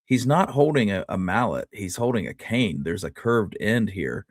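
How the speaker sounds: ordinary talk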